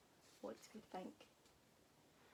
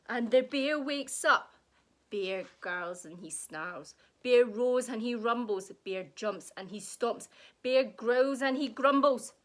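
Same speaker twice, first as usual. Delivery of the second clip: louder, booming narration; roaring storybook build; loud and dramatic; 11 dB louder than usual. A narrator's reading. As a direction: boom it out as the bear wakes. Bear wakes up, roars.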